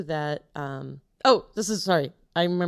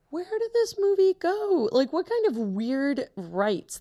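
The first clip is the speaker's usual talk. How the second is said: exasperated; 10 semitones higher, slower than usual. Real, not acted. Exasperated search for movie.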